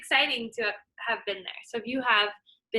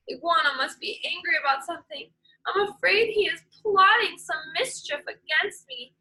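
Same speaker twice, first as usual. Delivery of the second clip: rising, faster fretful snake voice; worried python; 7 semitones higher, 3 dB louder than usual. Acted. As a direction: fret as if plotting is afoot.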